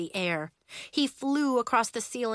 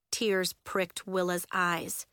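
usual speaking voice

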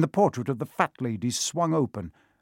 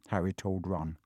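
usual speaking voice

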